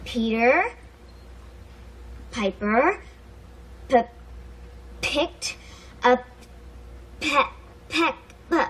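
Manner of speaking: stuttering on the p sound. Acted. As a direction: get stuck on the first sound. Stuttering girl tries tongue twister.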